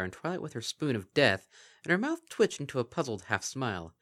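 high, formal royal voice; regal princess voice. Acted. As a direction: speak with regal poise. Luna character voice.

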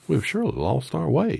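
high, loud complaining Scarecrow voice; grumbling Scarecrow. A narrator's reading. Scarecrow grumbles to friends about losing the way. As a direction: whine it like a fretful straw man. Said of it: "began to grumble a bit".

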